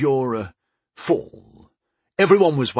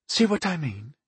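higher-pitched, dry aside; wry; 5 semitones higher, 4 dB quieter than usual. A narrator's reading. Woman noting apprentices are up to something, wryly. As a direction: point it out with wry suspicion. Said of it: "Pentandra said wryly".

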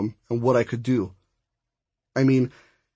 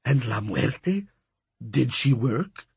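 put-on slow accented character question; questioning old suitor; 3 dB quieter, slower than usual. A narrator's reading. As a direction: ask it slowly, with curiosity. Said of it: "raised his bushy brows and asked".

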